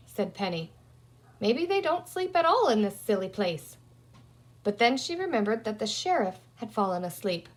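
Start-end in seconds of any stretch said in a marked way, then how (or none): 1.4-3.8 lightly voiced bossy girl, a little higher; a hint of a practical young girl working it out; Penny's voice, lightly raised. A narrator's reading. Penny scoffing.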